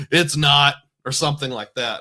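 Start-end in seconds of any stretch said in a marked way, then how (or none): 0.0-0.7 short imitated laugh, then a louder retort; mocking laugh. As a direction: laugh it off, imitating his reaction. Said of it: "laughed like".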